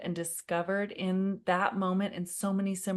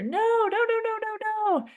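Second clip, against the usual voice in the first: high mock child scream; retold child screaming no; 4 dB louder than usual. Son at swim lesson, high mock scream. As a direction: cry out no like a panicked four-year-old. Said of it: "He is screaming in my ear".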